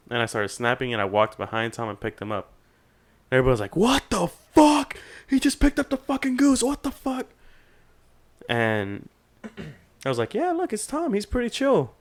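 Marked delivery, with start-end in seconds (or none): 3.7-7.2 mock astonishment. Everybody watches him pick up the goose, hammed-up astonishment. Crowd amazed he lifted goose. 10.3-11.9 very high-pitched, casual retold reply; acted breezy reassurance. Storyteller assures the fraternity the goose is harmless, acted nonchalance.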